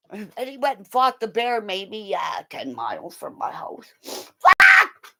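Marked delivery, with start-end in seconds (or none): 4.0-5.2 sudden high, loud yelp; exasperated shout. Acted. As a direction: yell the swear word out. Exasperated outburst about bear fight.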